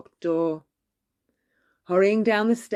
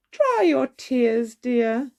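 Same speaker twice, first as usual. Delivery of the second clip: higher, slow motherly comfort; kindly godmother; 5 semitones higher, slower than usual. A narrator's reading. Godmother comforting crying Cinderella, slow and high. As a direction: soothe her like a fairy godmother. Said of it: "she said".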